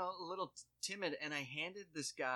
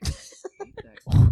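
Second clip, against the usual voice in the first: a secret inner whisper; whispered aside; 23 dB louder, slower than usual. Retold or imitated. Cashier whispering her private reaction to the customer. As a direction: whisper it to yourself, slow. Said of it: "And I was like".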